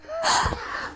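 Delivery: sharp demo gasp. Shown as a demonstration. Teacher showing class how to gasp, audible gasp. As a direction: suck in a quick, audible gasp. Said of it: "Can you gasp?".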